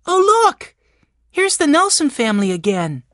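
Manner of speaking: put-on very high, loud child's exclamation; excited discovery. A narrator's reading. Benny spots the family's names again, exclaiming excitedly. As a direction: call out high, grabbing everyone's attention.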